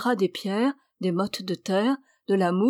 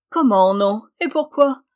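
indignant; 3 semitones higher, 8 dB louder than usual. A narrator's reading. Witch refused by the sister, indignant. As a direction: demand an explanation, affronted.

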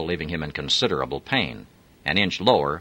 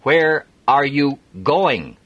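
each word spaced out, slow and higher; very slow and careful; 10 semitones higher, 7 dB louder, much slower than usual. Acted. Adam asking strangers for a lift, slow and careful. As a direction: spell it out slowly for a foreigner. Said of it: "pronouncing each word very slowly and carefully".

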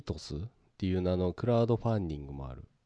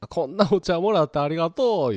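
gushing thanks; 6 semitones higher, 7 dB louder than usual. Retold or imitated. Streamer thanking donor.

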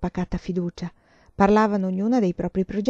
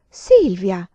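sharp loud call of a friend's name; loud startled exclamation; exclaiming; 9 dB louder than usual. A narrator's reading. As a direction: cry out her name in protest. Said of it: "esclamò Emanuela".

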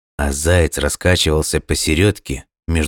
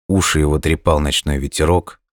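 usual speaking voice

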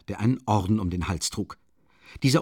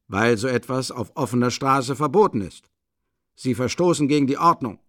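raised, official reprimand; stern policeman; 4 semitones higher, 6 dB louder than usual. A narrator's reading. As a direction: lay down the law as a policeman. (strenger Schutzmann, etwas lauter und höher)

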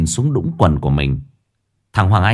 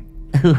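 high coy cackle; cackling giggle; 6 semitones higher than usual. A narrator's reading. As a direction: giggle like a coquette. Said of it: "cười khanh khách".

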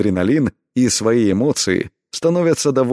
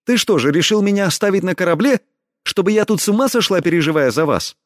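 high, fast indignant outburst; 10 semitones higher, faster than usual. A narrator's reading. Heroine objects to being left on the ship, indignant. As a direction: protest in a high voice, words tumbling out. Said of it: "вскочила на ноги в возмущении".

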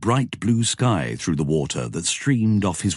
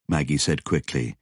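ordinary delivery

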